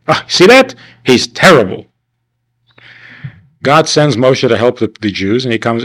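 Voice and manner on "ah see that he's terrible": much higher, sing-song gotcha; mock accusation. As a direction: point the finger in jest. Mocking the commentators' verdict.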